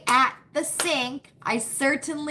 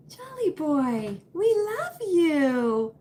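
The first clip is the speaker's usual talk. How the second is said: cooing at dog; 3 semitones higher, slower than usual. Real, not acted. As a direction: coo over the sleepy puppy, drawn out.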